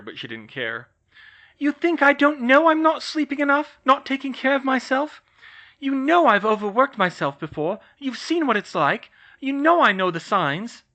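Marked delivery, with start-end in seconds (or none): 1.6-10.8 loud, high, heated tirade; angry outburst. Acted. Hermione raging at George, loud and high. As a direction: keep hammering him with it.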